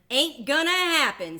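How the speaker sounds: high, loud cheeky refusal; second duckling flatly refusing; sassy duckling. Acted. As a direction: brush it off with attitude.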